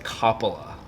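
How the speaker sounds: Coppola as "KOP-uh-luh", opening like cop; disliked pronunciation. Shown as a demonstration. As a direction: stress a short "cop" at the start of Coppola. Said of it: "it looks like it says cop".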